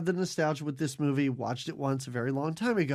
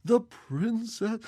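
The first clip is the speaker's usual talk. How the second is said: put-on slow, halting old man; a doddering elder groping for his line; 6 semitones higher, much slower than usual. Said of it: "old guy who can barely deliver his lines".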